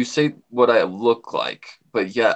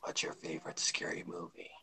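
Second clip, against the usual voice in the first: creepy killer whisper; 7 semitones lower, 20 dB quieter than usual. Acted. Quoting the killer's phone call.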